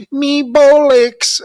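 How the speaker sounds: mock Cockney accent. Mocking the rough English accent while talking about an actress, in an over-the-top Cockney accent. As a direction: bark out "me bollocks" like an over-the-top Cockney lad. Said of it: "Just that sexy English accent, not the ... accent".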